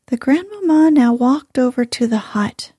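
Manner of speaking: ordinary delivery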